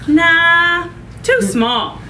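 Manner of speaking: doctor. Acted. Doctor rejects needle.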